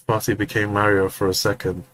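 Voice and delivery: usual speaking voice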